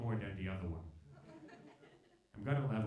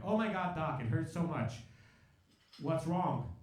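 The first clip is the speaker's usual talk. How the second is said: hammed-up hurting patient, raised voice; mock pained plea; 3 semitones higher, 4 dB louder than usual. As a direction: appeal urgently to the doctor, pitched up at the start. Retold plea to doctor in pain.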